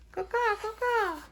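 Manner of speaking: very high cawing crow; high bird caw. Acted. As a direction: screech like a crow. Crows in story.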